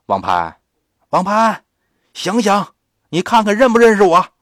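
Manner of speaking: 又高又响，加快语速冲着孩子喊; 扯开嗓门急促地连声叫唤; 大声急喊. Acted. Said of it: "接着对着这孩子呀喊". (打完孩子后冲着他高声急喊)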